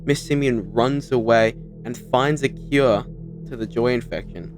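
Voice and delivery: ordinary talk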